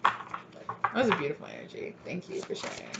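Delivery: soft whisper of gratitude; whispered thanks to a spirit guide. Real, not acted. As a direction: breathe the thanks out, barely voiced.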